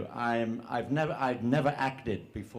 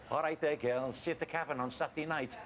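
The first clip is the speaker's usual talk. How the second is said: quick, chirpy Scouse chat-up line, pitched up; breezy Scouse greeting, slightly higher and quicker; Scouse delivery; 5 semitones higher, 7 dB quieter than usual. Shown as a demonstration. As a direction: toss it off fast in a Liverpool accent. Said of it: "the voice is just the way you deliver it".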